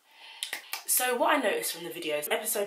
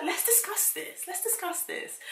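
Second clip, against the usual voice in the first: much higher, slow whispered mock politeness; mock sweet whisper; 13 semitones higher, 3 dB quieter than usual. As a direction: whisper it high and slow, faux calm. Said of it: "She was like".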